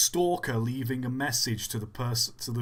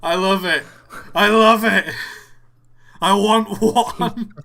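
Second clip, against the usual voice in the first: loud, higher-pitched delight; gleeful, emphatic shout of approval; thrilled outburst; 6 semitones higher, 11 dB louder, slower than usual. Real, not acted. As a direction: burst out loving it.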